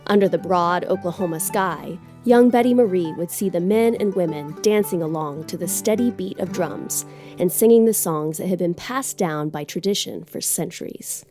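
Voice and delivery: plain reading voice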